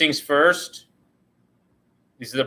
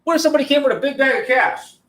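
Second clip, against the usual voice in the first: much higher mock-teasing question; joking high voice; 11 semitones higher than usual. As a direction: replay the joke line, cartoonishly high. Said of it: "I just wanted to have some fun with it".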